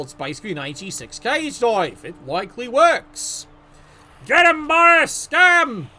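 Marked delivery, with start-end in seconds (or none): 4.2-5.9 yelling encouragement loudly; shout. Acted. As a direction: cheer him on, loud and high. Cheering on a player.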